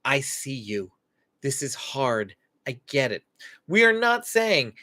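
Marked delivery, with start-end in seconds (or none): 0.1-3.2 softer and quiet, just being with them; gentle acknowledgement. Shown as a demonstration. Parent telling an overwhelmed child, soft and gentle. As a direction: quietly let the child feel seen. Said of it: "we are telling our child".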